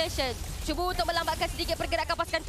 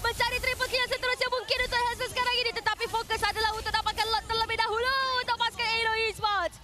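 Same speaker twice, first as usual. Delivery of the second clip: excited play-by-play; 6 semitones higher than usual. Real, not acted. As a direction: call the teamfight like a hyped caster. Hyped live play-by-play.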